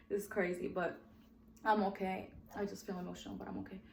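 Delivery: fighting tears, reassuring herself; getting emotional. Real, not acted. Vlogger choking up talking about her son, softly tearful. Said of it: "I'm getting emotional".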